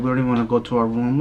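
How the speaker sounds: ordinary delivery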